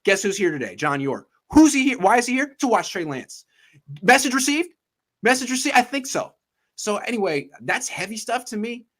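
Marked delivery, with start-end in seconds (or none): 1.5-6.3 high-pitched, punchy question and answer; emphatic rhetorical questioning. Acted. Host explaining the owner's visit as a message, emphatic. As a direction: pitch up sharply, driving the point home.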